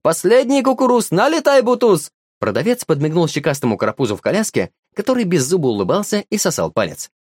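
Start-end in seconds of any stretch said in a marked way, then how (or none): 0.0-2.1 high, loud hawker's cry; vendor calling out. A narrator's reading. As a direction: bellow it to the market crowd. Vendor hawking corn.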